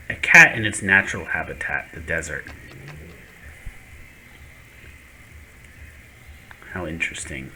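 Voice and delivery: hushed wildlife narrator, amused; nature documentary. Pretend. Narrating a cat in the desert.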